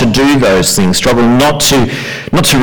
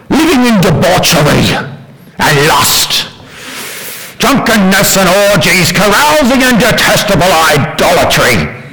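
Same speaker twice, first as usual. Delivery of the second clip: mock-scandalised listing, pitched up; how outsiders hear it; 6 semitones higher than usual. Shown as a demonstration. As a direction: list raised higher as a lurid accusation. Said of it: "That's what they're hearing".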